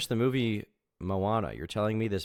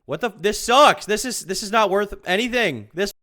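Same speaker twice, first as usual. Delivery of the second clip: building to a furious full-throated shout, much higher and louder; frustrated yelling; 10 semitones higher, 18 dB louder than usual. Real, not acted. Streamer screaming at the awful podcast he's paid to watch, furious shout. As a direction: explode at the video you hate. Streamer losing it at bad video.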